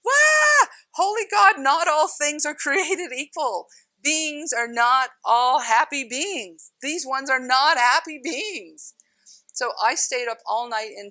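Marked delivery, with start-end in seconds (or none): 0.0-0.7 a sudden high loud shriek; a much higher, shouted wah of terror; terrified shriek. Retold or imitated. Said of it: "and I'm like". 0.9-8.9 higher, louder panicked cry; frightened exclamation, higher and slow; retold terror. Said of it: "and I'm like".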